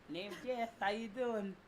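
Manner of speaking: lightly put-on proper English accent; mock posh English greeting; a hint of a proper English accent. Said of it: "I'll use my proper English voice".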